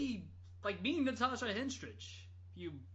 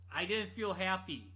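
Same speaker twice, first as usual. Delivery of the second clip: ordinary delivery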